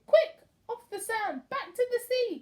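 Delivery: high, loud urgent cry. A narrator's reading. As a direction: shout to get the whale moving. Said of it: "cried the snail".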